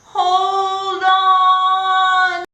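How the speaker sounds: high, loud call of Hold on. Acted. Elephant calling up to the girl on the slide, loud and high.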